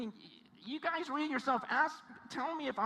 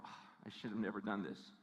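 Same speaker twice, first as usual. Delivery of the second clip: low, quiet mock dismay; regretful; 14 semitones lower, 10 dB quieter than usual. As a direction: sigh it out like a quitter. Imagined soldier regretting the battle.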